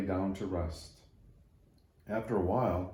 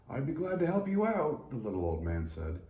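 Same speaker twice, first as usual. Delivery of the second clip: put-on high, folksy old man voice; a gruff-kind old carpenter sizing up wood; little old man; 8 semitones higher than usual. Old man telling the tree it will become a manger, high and folksy.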